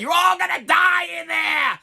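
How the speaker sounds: slow, high, drawn-out doom shout; preacher screaming. Retold or imitated. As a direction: scream the warning like a crazed old pastor. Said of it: "he starts screaming out".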